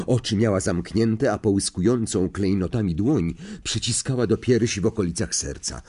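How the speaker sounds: usual speaking voice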